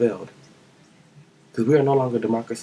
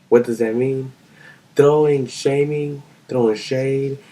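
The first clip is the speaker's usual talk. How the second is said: ordinary talk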